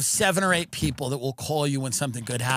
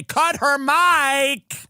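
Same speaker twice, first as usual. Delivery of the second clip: drawn-out yell; 9 semitones higher, 4 dB louder, much slower than usual. Retold or imitated. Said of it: "I'd say".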